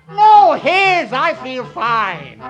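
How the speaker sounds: high, loud, drawn-out protest; shouted reassurance. Acted. Johnny assuring Jessie he is alive, shouting. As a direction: holler it down the phone to reassure her.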